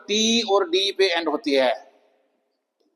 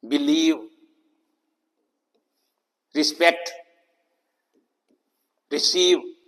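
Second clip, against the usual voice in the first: Believe, respect, receive with light short-i first syllables; second-syllable stress. Shown as a demonstration. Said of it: "बाद वाले syllable पे". (Believe, respect, receive में ज़ोर दूसरे syllable पर)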